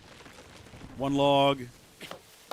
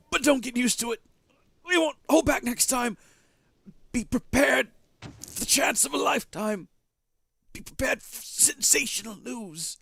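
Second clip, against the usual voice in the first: much higher, teasing announcer; high showman voice; 10 semitones higher than usual. Acted. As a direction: hype it like a carnival barker. Voices another character high.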